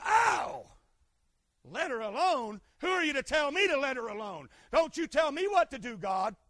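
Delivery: loud, indignant retort; offended Judas. Acted. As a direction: voice Judas snapping back, stung. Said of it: "And Judas went".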